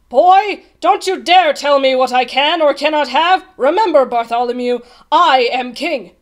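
loud, indignant royal rebuke; angry command. A narrator's reading. King putting his page boy in his place, furious. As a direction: thunder it, slowing on the last words.

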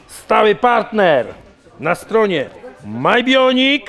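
high, slow, drawn-out ad plug; sponsor ad read. Acted. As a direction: sell the sponsor code like a hyped announcer. Sponsor shout-out mid interview.

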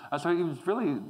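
usual speaking voice

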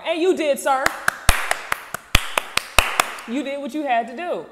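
loud, high cheer of approval; emphatic approval. Real, not acted. Host backs dad for defending his daughter, emphatic. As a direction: back them up, loud and high.

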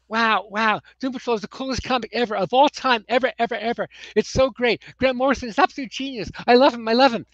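put-on totally geeky kid. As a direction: gush like an overexcited nerdy kid. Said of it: "in the voice of this totally geeky kid".

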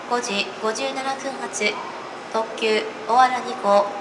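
ordinary talk

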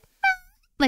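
very high happy little meow; happy meow imitation. Streamer imitating her cat's happy meow, very high squeak. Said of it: "it's kind of like she's like".

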